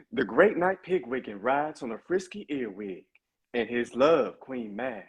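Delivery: smooth, cool gangster character voice. Shown as a demonstration. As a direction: lay it back like a cartoon street hustler reading his lines. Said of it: "would you do your [name] for us".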